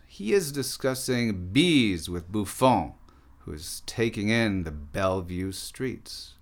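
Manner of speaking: measured, declaimed poem performance; poem reading. Acted. Artist performs a poem about philosophers, declaimed. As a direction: recite it with deliberate phrasing and pauses. Reads own poem aloud.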